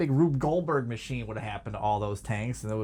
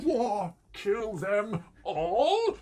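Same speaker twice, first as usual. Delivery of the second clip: high, very slow theatrical British delivery; exaggerated British stage accent; 6 semitones higher, much slower than usual. Fan imagines Clayface suddenly declaiming, exaggerated British stage accent. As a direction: ham it up like a British stage actor, drawing out each word. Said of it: "speaking in that exaggerated British stage accent".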